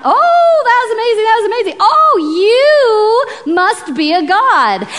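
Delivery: high, loud awestruck gushing; big drawn-out "ohs" of hammed-up amazement; crowd freaking out. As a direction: gush like an amazed crowd, wildly over the top. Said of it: "all the people freak out".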